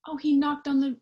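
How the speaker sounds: plain reading voice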